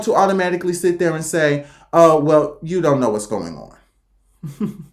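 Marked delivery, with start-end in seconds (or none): 1.9-4.9 low, slow and trailing away; dismissive low drawl; muttered dismissal. Retold or imitated. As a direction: let it sink low and drag it out. Imagined dismissive reaction to critics.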